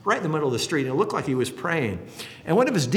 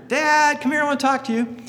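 higher and louder call up to "Dad"; calling boy; 13 semitones higher, 5 dB louder than usual. Retold or imitated. Son upstairs calling his father, high boy's call. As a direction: shout for father like a young son.

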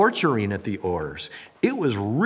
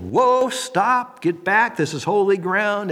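urgent warning; 7 semitones higher, slower than usual. Pretend. God stopping Moses at the burning bush, urgent warning. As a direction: halt him sharply.

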